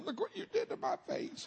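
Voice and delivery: very high-pitched comic outrage; mock outrage. Burned man mock-accusing the driver who hit him, high mock outrage. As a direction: wail it in mock indignation. Acted complaint to the other driver.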